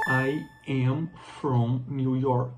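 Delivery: "I am from New York" said slowly and clearly; slow model English. Shown as a demonstration. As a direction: model it for learners. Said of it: "ela vai falar". (pronúncia lenta e clara)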